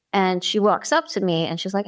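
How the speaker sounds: ordinary talk, nothing marked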